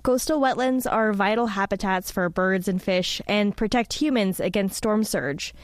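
ordinary talk

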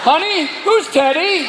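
high, slow questioning of a partner; suspicious spouse. Acted. Spouse reacts to parrot's revelation.

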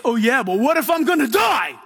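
mock panicked doubter. Preacher voices the listeners' hidden doubts, in mock panic. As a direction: wail the worry like an exaggerated worrier. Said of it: "I know what's in the back of your head".

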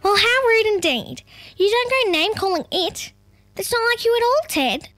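high-pitched, blustering emu lady; Gladys, blustering. A narrator's reading. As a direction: scold indignantly in a high character voice. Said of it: "blustered Gladys".

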